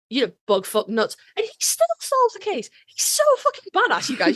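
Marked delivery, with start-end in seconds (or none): 1.4-4.1 gushing excitement. Real, not acted. Host gushing to listeners about badass Sam, excited. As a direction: pitch jumps way up, gushing.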